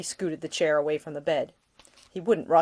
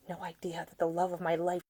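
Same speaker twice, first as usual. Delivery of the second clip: tender; 7 dB quieter than usual. Acted. Nick trails off in tender confession.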